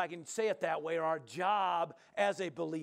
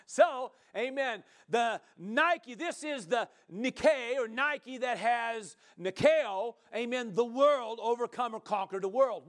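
loud, high, stretched-out preacher delivery; fervent preaching; 6 semitones higher, 5 dB louder, slower than usual. Real, not acted. Preaches at full force.

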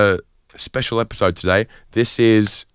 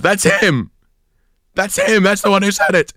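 high-pitched, outraged imitation of a woman; mock-hysterical female accuser; accusing woman; 11 semitones higher than usual. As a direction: point out the culprit, high and urgent. Woman accuses him, acted out.

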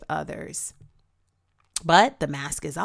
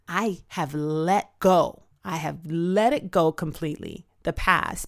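slow emphatic; 3 dB louder than usual. Real, not acted. Stressed testimony of release.

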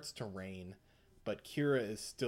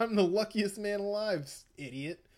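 higher, louder, laughing taunt; gloating villain; 5 semitones higher, 4 dB louder than usual. Retold or imitated. Villain mocking the boy he survived, laughing taunt. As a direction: crow over the boy through laughter.

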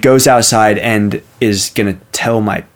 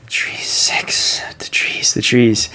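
hushed whisper, long gaps between words; creepy sleep-talk whisper; 3 semitones higher than usual. Retold or imitated. Faking sleep talk to spook Avery, eerie whisper. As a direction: whisper it as if talking in your sleep. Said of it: "just really silently, just go".

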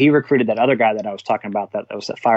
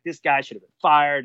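mildly heated retold reprimand, pitched higher; retold scolding; 4 semitones higher than usual. Battalion chief chewing out his officer, mildly heated. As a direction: act out a boss's dressing-down, lightly. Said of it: "he starts chewing me".

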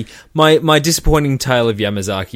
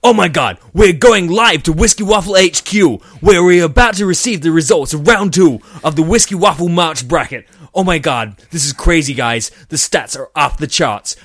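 loud, higher American news anchor; American accent; 5 semitones higher, 4 dB louder than usual. Pretend. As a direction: hype it like an American broadcaster. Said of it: "like I'm trying to do at the moment".

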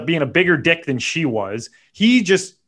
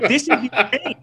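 incredulous; 10 semitones higher than usual. Retold or imitated. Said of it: "I'm like".

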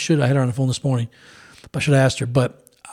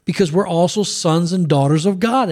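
usual speaking voice

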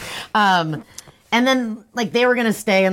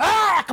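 high imitated attack yell; short, high cry before throwing punches; playful fighting shout; mock battle cry; 11 semitones higher than usual. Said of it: "Paul was like".

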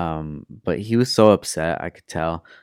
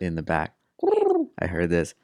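ordinary delivery

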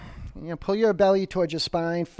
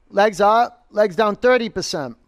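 loud coach call; 6 semitones higher, 8 dB louder than usual. Real, not acted. Pushing class through leg lowers.

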